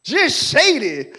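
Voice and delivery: slow drawl. Real, not acted. Preacher drawls a verdict.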